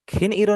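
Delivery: खिन ईरो with a hard न, tongue touching the roof; wrong hard n. Shown as a demonstration. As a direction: split it into खिन and ईरो with a full n. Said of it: "खिन ईरो नहीं पढ़ रहा". (खिन ईरो, सख़्त न के साथ)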